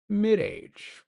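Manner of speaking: high mock British pronunciation; playful British accent; fancy British accent. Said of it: "a fancy British accent".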